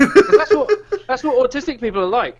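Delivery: high, loud laughing words; laughing through the words. Real, not acted. Laughing while teasing a friend.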